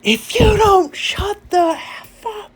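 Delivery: mock the daughter screaming at her mother; daughter yelling at mom. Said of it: "I hear my daughter go".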